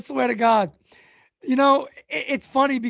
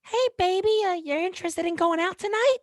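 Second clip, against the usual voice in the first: put-on much higher, unbroken boyish voice; imitating his teen voice; 10 semitones higher than usual. Streamer imitating his high teenage voice asking a girl out. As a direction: squeak it out like a kid whose voice hasn't dropped. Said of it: "your boy's voice".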